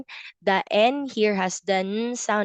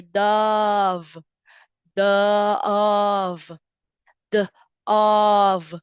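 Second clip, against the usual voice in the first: slow modeled word; much slower than usual. Shown as a demonstration. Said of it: "It's at the end".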